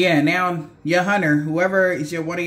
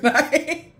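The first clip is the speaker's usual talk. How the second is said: laughing; 8 semitones higher than usual. Real, not acted. Laugh at the attacker.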